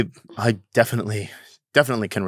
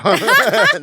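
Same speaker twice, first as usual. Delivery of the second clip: laughing; 8 dB louder than usual. Real, not acted. Refusing to do voice.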